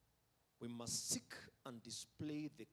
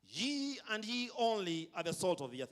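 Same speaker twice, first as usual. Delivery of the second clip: "ye" and "only" louder and higher; a louder, higher lead line for the church to echo; stress on ye only; 4 semitones higher, 5 dB louder than usual. Shown as a demonstration. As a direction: call it out for the people to repeat. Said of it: "Let's try and say that after me".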